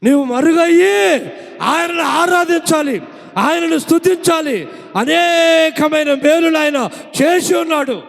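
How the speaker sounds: high, loud, ringing declarations; preaching at full force. Acted. Preacher loudly declares praise to God to the congregation. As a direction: drive each line home to the church.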